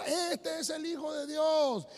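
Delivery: slow, high preaching cry; proclaiming. Retold or imitated. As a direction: draw it out as a ringing public proclamation. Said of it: "lo que iba diciendo".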